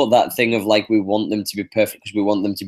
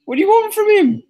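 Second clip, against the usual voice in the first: high defensive protest; 3 dB louder than usual. Real, not acted. Defending the player.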